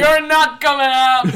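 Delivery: loud, comic refusal; mock defiant shout. Parent forbidding the baby to come out, mock shout. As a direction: bellow it as a joking order.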